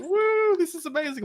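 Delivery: very high-pitched gushing fans; mock excitement. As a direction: squeal like a thrilled crowd. Mimics gushing video viewers.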